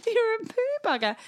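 very high mock kid voice; squeaky-high childish taunt; child's insult. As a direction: imitate a little child name-calling.

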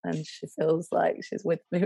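ordinary delivery

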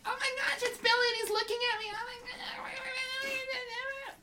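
swooning gasp. Acted. Diary girl swoons over Billy.

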